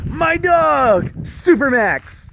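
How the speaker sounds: slow high proud intro; grand announcement. Acted. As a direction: present the dog like a star.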